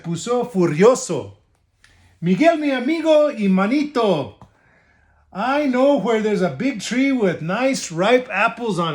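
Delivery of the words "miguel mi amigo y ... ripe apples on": high sweet-talking wolf; sly wolf. Acted. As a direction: charm him again. Wolf sweet-talks again.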